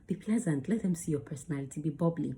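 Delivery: ordinary talk, nothing marked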